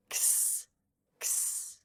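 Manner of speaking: "X" as just a soft voiceless ks sound; unvoiced ks. Shown as a demonstration. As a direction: make only the sound ks, no vowel. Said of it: "is also unvoiced".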